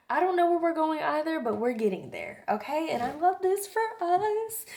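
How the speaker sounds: goofy, high-pitched singsong excitement; high, bubbly goofing around; being playfully weird. Real, not acted. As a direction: get silly and let the pitch climb. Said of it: "I'm gonna stop being weird".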